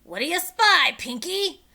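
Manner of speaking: high loud pirate captain bellow; captain shouting up to his crewman. A narrator's reading. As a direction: bellow up to the treehouse. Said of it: "shouted Captain Bones".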